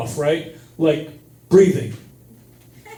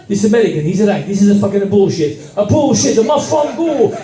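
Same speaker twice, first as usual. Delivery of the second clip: put-on Italian accent. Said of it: "This Italian guy goes".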